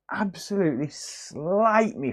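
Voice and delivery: slowed, drawn-out "absolutely slate me for this". Shown as a demonstration. Streamer predicting Lee's criticism, slow drawn-out delivery. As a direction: stretch each word of "slate" out. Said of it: "he's gonna".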